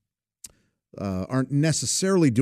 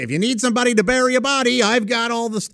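high, fast, shady bar-owner offer; Aruba Ray voice; 10 semitones higher, faster than usual. Acted. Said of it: "he often say".

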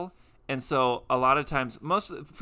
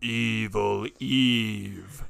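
spooky announcer; 3 semitones lower, much slower than usual. Pretend. Spooky slow name.

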